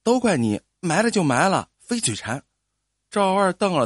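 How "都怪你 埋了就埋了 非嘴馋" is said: usual speaking voice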